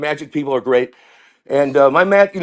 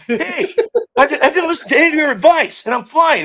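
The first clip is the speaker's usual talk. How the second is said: much higher, laughing taunt from up high; gloating brag; 11 semitones higher than usual. Retold or imitated. Skydiving student taunting the instructor from the top, high gloating crow. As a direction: crow at the instructor like a smug kid.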